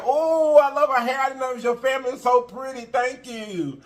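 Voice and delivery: high, sweet delighted chatter; gushing lady voice. Retold or imitated. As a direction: coo like a charmed old lady. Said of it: "she said".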